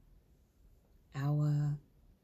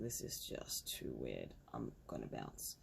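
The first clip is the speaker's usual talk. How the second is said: dropped very low and quieter; low aside; 9 dB quieter than usual. Retold or imitated. Retelling the decision to leave the shop, low muttered aside. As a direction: sink to a low, slow mutter to yourself.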